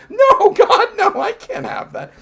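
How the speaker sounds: hammed-up recoil from the idea; mock horrified squeal. Voicing the guest's horrified refusal in a high mock squeal.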